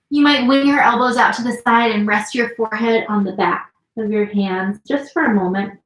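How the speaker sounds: ordinary talk, nothing marked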